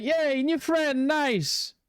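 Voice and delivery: high, drawn-out happy cheer; excited cheer. Retold or imitated. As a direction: mimic your delight at a new friend. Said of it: "I was like".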